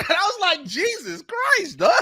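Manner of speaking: very high, laughing disbelief; laughing exasperation. Retold or imitated. Host reacting to harsh comments, laughing exasperation. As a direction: squeal it through laughter, drawn out.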